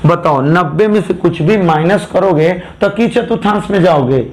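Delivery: ordinary delivery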